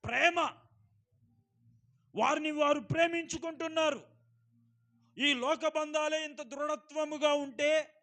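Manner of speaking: loud, ringing pulpit voice; preaching at full force. Acted. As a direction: preach it out to the whole hall. Preacher proclaims love as root.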